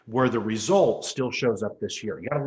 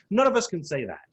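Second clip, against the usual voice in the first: usual speaking voice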